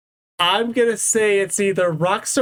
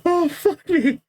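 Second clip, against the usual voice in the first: normal talking voice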